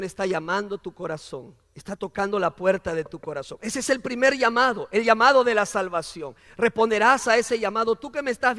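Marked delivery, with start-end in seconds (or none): none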